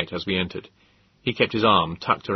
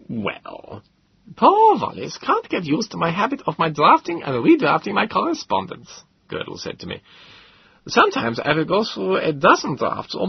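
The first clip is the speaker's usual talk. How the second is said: pedantic professor; 13 semitones higher than usual. Acted. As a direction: spell out the Greek, pedantic. Gödel character voice.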